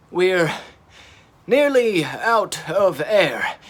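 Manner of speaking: slow, gasping for air; breathless gasp. A narrator's reading. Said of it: "gasped Tegs".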